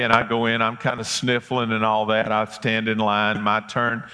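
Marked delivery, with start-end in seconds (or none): none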